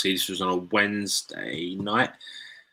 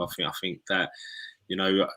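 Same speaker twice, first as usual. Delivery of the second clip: ordinary delivery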